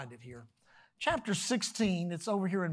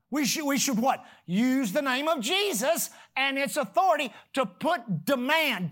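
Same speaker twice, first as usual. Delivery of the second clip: normal talking voice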